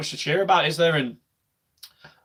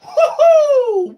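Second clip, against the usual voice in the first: celebratory whoop; 7 dB louder than usual. Real, not acted. Goal celebration.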